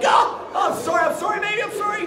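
high, loud yelped apologies; frantic apology. Retold or imitated. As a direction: act out a frantic shouted sorry. Retold frantic apology to wife.

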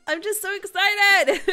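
loud, high, laughing outburst; excited laughing. Real, not acted. Player overjoyed at finishing the center, laughing loudly. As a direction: burst out laughing with real excitement.

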